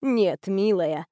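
low, slow, gentle reassurance; tender reply. Acted. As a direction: soothe the girl kindly. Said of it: "ласково ответила Степанида Андреевна".

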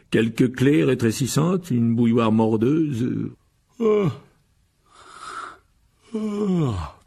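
slow, drawn-out yawning reply. Acted. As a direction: talk through big yawns. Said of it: "Répondit monsieur Wesley en bâillant". (en bâillant longuement)

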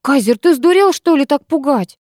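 выше и громче, с возмущением; отчитай его, задыхаясь от возмущения; возмущённо. Acted. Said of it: "задохнулась от возмущения". (возмущённый окрик, выше и громче)

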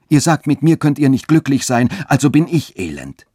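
höher und lauter, drängend; verzweifelt ausgerufen. A narrator's reading. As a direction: wie ein Aufschrei der Verzweiflung. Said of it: "rief Friedrich aus". (der Jüngling ruft der Frau verzweifelt zu, höher und lauter)

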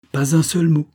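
high, emphatic, falling firmly; determined. A narrator's reading. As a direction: cut him off with determination. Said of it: "dit-elle avec détermination".

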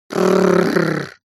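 low growl. Pretend. Dragon growls from afar.

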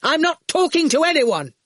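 high, loud refusal; angry shout. A narrator's reading. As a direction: shout it, refusing all contact. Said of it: "Archer shouted".

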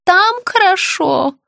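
put-on high, slow, childlike pleading; confused old woman. A narrator's reading. Sick woman begging to see her child, confused high voice. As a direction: plead slowly in a high little voice.

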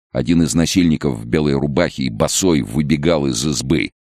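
ordinary delivery

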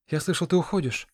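breathless exclaim. A narrator's reading. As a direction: burst in panting and call out. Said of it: "задыхаясь, воскликнул он".

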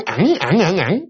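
imitated short dog yelps; little repeated whimpers. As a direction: mimic a dog whining at its owner. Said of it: "cái nó kêu".

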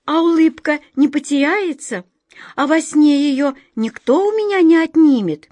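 put-on a small girl afraid to lose her smile; anxious little girl. Glazastik asks anxiously.

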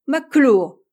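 loud, high, pouncing retort; American pouncing on the name, loud retort; sharp retort. Acted. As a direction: snap the name back at him. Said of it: "riposta vivement l'Américain".

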